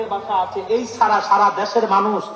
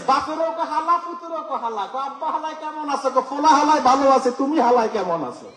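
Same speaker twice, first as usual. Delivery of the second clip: laughing, higher mimic of old Dhaka speech; old Dhaka dialect; 4 semitones higher than usual. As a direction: tease old Dhaka slang with a chuckle. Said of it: "ঢাকায় যান".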